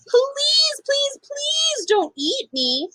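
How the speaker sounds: high, slow pleading mouse voice; begging mouse. A narrator's reading. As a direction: beg for your life as a tiny mouse. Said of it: "begged the mouse".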